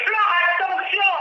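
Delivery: shouting a warning to Flore; calling out a warning. Retold or imitated. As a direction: call out to warn her across the room. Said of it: "J'ai dit".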